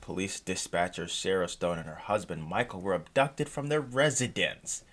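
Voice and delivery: normal talking voice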